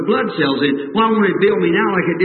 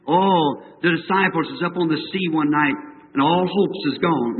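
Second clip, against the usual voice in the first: normal talking voice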